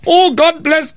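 high, hammed-up Irish mum; mates' exaggerated take on an Irish accent; mimicked Irish accent. Schoolmates mimicking his Irish mother, exaggerated Irish accent. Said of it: "all my mates would go".

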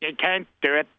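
a mild mock Derry accent thrown back at the caller; mock Northern Irish accent. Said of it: "a Derry accent".

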